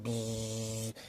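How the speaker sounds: soft imitated buzzing of a tattoo gun. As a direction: hum like a tattoo machine. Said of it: "He's like".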